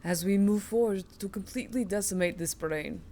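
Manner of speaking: in a Russian accent, announce it with mock gravitas. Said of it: "The Russian accent has been requested".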